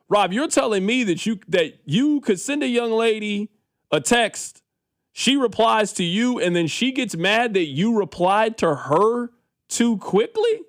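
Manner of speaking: high, slow, climbing in disbelief, peaking on quickly; can't believe what you're hearing; incredulous disbelief. Real, not acted. Incredulous at radio caller Rob.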